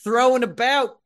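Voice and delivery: put-on high, loud drunken tirade; drunk angry rant. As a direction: rant at the trespasser. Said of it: "He goes".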